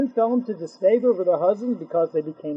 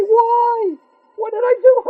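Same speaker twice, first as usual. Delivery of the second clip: much higher, whiny mock-innocent plea; feigned innocence; 13 semitones higher, 5 dB louder than usual. Husband feigns innocence to Hashem, high whiny plea. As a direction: play the wide-eyed innocent who did nothing. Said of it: "in feigned innocence".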